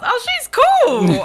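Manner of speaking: very high, loud, drawn-out gush; loud high-pitched thrilled realization; delighted discovery. Retold or imitated. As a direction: squeal it slowly in fan excitement. Acting delighted discovery of rapper.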